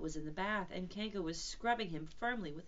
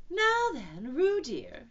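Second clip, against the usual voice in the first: motherly Kanga; 9 semitones higher, 10 dB louder, much slower than usual. Acted. Kanga playing mother to Roo.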